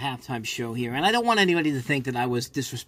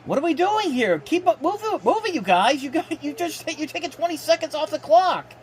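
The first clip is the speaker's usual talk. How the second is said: exasperated urging; 13 semitones higher than usual. Real, not acted. Streamer frustrated at slow offense.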